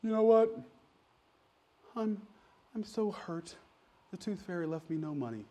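soft, mock-wounded kid; imitating hurt child. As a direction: play a little kid quietly heartbroken. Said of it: "kids that come to me and say".

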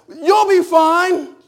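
loud, high, slow brush-off; dismissive parent. Retold or imitated. Mother brushing off a child's heat stroke, loud and slow. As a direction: drag it out like a dismissive mom. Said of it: "My parents just be like".

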